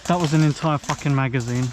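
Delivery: loud furious complaint; angry outburst. Real, not acted. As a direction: vent it loudly. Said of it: "my annoyed self".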